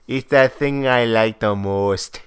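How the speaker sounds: slow, drawn-out mock Minnesota accent. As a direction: imitate a Minnesotan woman, savoring each word. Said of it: "They're just laughing at her accent".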